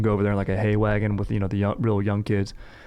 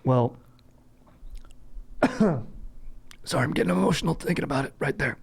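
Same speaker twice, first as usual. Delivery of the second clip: strained, higher voice just after a cough; real choked-throat voice, hurrying through; 4 semitones higher than usual. Real, not acted. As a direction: squeeze the words out quickly past a tickle in the windpipe. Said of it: "sugar got lodged in my windpipe".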